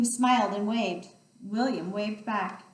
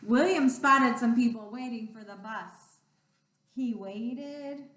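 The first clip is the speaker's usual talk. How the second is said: ordinary delivery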